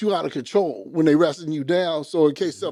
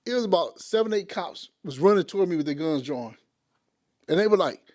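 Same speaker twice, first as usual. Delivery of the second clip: normal talking voice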